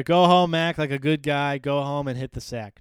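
hammed-up cab driver telling him off; mock cab driver. A mock cab driver loudly sends a drunk teen home.

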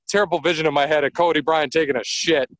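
normal talking voice

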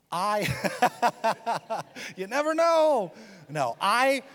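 laughing through the words at his own joke. Real, not acted. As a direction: chuckle while teasing his wife.

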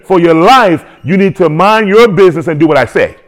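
preaching at full force. Real, not acted. Voicing God's command, preaching.

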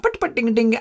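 high singsong mock Indian patter; quick high-pitched parody of an Indian accent. Kids taking the piss, mock Indian accent. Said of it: "because you were talking like this".

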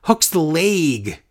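"leg" drawn out as "layg"; slow call, vowel of "leg" stretched to "lay-g"; Michael Cole's "leg". Retold or imitated. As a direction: say "leg" to rhyme with "vague". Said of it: "Just like he says".

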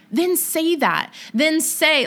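high, slow, insistent comeback; exasperated retort. Retold or imitated. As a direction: push back at your husband in mock exasperation. Acting her exasperated retort to husband.

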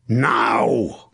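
drawn-out battle command; knight's bellowed signal. A narrator's reading. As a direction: bellow it as an order to strike. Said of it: "he bellowed".

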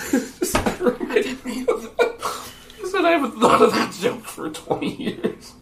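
high, wheezy words through laughter; breathless, squeaking laughter; real laughing fit. Real, not acted. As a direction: struggle to talk while cracking up. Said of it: "he's laughing at his own joke".

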